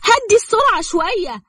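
high-pitched panicky plea; frightened. A narrator's reading. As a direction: beg him in a high, alarmed voice. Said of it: "وهي قالت له بخوف".

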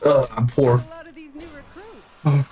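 normal talking voice

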